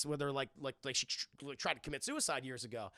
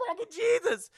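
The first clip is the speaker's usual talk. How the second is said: high, loud pained yell with a laugh; yelled curse; 9 dB louder than usual. Retold or imitated. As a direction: yelp it out, half laughing. Mother yells in pain.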